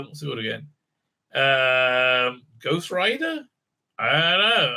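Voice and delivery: ordinary delivery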